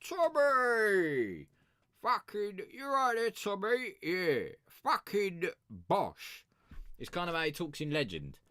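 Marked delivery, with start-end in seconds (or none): 0.1-6.5 loud, high mock cockney bellow; hammed-up London hard man; Peaky Blinders gangster. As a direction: shout at Tommy like a rowdy gangster. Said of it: "And then he's like".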